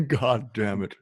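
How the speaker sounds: slow, laughing attempt at Hank Hill; failed Hank Hill. Trying Hank Hill's line and failing, laughing. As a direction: try Hank and give up laughing. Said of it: "I can't do that".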